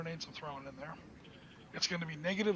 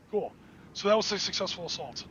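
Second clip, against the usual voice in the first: ordinary delivery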